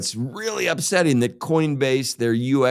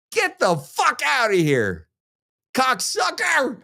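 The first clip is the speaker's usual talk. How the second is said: mad yell; 11 semitones higher than usual. Real, not acted. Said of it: "it gets me so mad".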